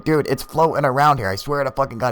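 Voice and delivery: high-pitched mock pleading; exasperated insistence. Friend tries to explain the light, mock insistent. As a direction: swear to it, high and worked up.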